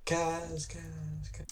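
high repeats fading away; high, playful echo tailing off; mock echo. Echoing end of drawn-out title.

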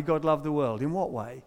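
ordinary delivery

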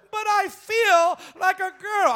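mock child. Mocking the child's voice.

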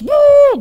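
high, loud "woo" imitating wild kids; playful kid whoop; excited woo. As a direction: whoop like a hyper child. Kids going every which way.

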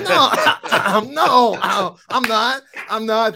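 loud, high, stammered protest; acted-out defensive denial, laugh breaking in; flustered denial. Acts out flustered denial to wife.

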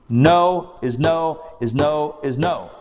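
"No is no is no is no" spelled out slowly and firmly, each "no" hit; slow emphatic insistence. Real, not acted. Teacher tells students to refuse without argument, slow and insistent. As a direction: press every "no" slowly, leaving no room to argue.

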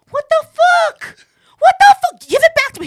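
high-pitched indignant yelling; outraged shout. Retold or imitated. Clubber whose ID was just snapped, yelling in outrage. Retold quarrel with the bouncer.

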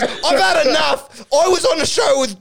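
high, hammed-up rant; mock exasperated outburst. Acted quarrel, host loses patience.

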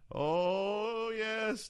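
lightly imitated church preacher's "Oh, yes"; a hint of a sermon cry, sliding up; host mimicking a church preacher's fervour, lightly. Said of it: "to that, uh, voice".

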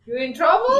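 high, loud, rising taunt; playful you're-busted singsong question; mock warning. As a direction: tease like someone about to watch a scolding.

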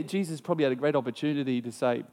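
normal talking voice

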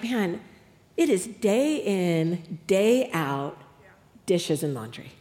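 low, slow weary lament; mock-defeated, sinking resignation; at wit's end. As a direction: intone it as endless drudgery. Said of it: "I'm just at the end of myself".

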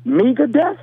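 high-pitched mock disbelief; incredulous retelling. Uncle retells grilling his nephew over his outfit, incredulous. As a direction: retell it as a baffled, scandalized scolding.

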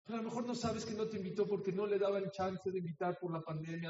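ordinary delivery

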